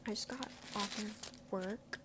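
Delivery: talking through a bite; words muffled by food; mouth full. Real, not acted.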